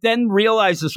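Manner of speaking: ordinary talk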